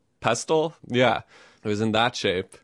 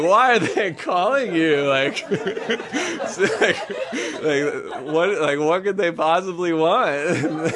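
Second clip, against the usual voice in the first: high, incredulous question through laughter; cracking up; 7 semitones higher, slower than usual. Retold or imitated. Parents at campsite hear the boys calling, cracking up. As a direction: imitate amused parents breaking into laughs. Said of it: "they're cracking up. They're like".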